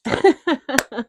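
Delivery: high, short burst of laughter; amused laugh. Real, not acted. Host tickled by the fake phone call, laughing. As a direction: laugh at your own silly bit.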